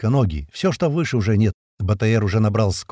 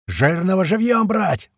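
slow, high-pitched battlefield call; drawn-out yell over the fighting; yelled order; 8 semitones higher, slower than usual. A narrator's reading. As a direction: stretch the words out as a shouted command. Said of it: "орёт кто-то".

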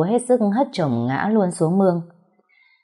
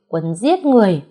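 higher, louder outraged cry; furious accusation; 4 semitones higher, 4 dB louder than usual. Acted. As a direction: hurl the accusation at her. Furious mother-in-law accuses.

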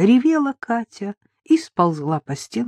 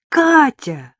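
громко и высоко, от радости кричит в трубку; обрадованно заорать имя; радостный крик; 10 semitones higher, 6 dB louder, much slower than usual. Acted. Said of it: "обрадованно заорали в трубке". (друг радостно кричит Кате в трубку, громко)

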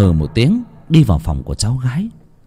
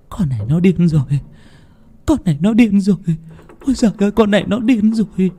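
higher, rising panic, faster at the end; old woman frightened, repeating herself; panicked muttering; 10 semitones higher, faster than usual. Acted. Grandmother panicking about her granddaughter, higher and frantic.

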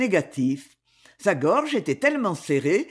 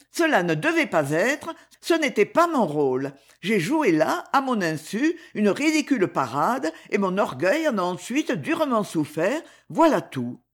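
plain reading voice

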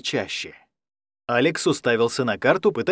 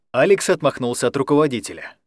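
usual speaking voice

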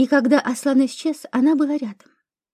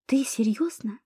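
breathy stunned whisper; shocked gasp; 2 semitones lower, 6 dB quieter, slower than usual. A narrator's reading. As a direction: exhale it in shock. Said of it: "шокированно выдохнула подруга".

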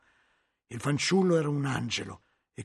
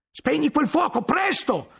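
high urgent shout, full voice; 13 semitones higher, 7 dB louder than usual. A narrator's reading. Guglielmo yelling as the books catch fire, urgent shout. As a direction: yell for someone to put out the fire. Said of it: "gridò Guglielmo".

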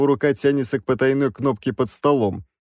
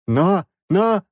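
high, halting protest; agitated stammer; 9 semitones higher, 3 dB louder than usual. A narrator's reading. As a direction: falter on a repeated word in panic. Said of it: "От волнения Краевский начал заикаться".

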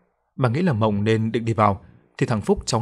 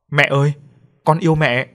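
higher, louder narrated cry to mother; loud shout; 5 semitones higher, 5 dB louder than usual. A narrator's reading. Daughter calls back to her mother before leaving, loud shout. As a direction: call it out, raised but not full force. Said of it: "cô quay lại nhìn mẹ hét to".

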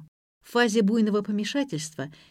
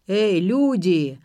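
put-on slow, loud drunken call; a drunk calling out from the kitchen; 5 dB louder, much slower than usual. A narrator's reading. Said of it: "раздался из кухни пьяный голос".

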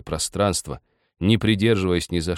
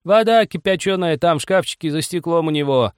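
put-on high, raised old woman's call; 11 semitones higher, 5 dB louder than usual. A narrator's reading. Old woman calls from the kitchen, high shout. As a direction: shout it through from another room. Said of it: "крикнула из кухни расслышавшая старуха".

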